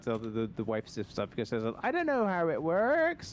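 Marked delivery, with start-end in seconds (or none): 1.8-3.3 mock-baffled partner. Quoting someone puzzled by men's anatomy, mock-baffled. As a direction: imitate someone clueless in a raised voice.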